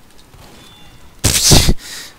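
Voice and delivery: a sudden loud laugh, breathy and unvoiced; loud burst of laughter. Real, not acted. Gamer bursting out laughing at his team's pick.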